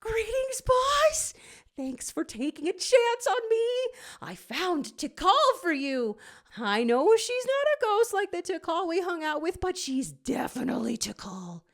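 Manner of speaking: high excited intern reading his letter; Dave the intern. A narrator's reading. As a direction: gush like a keen intern writing the boss. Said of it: "he hears Dave the intern".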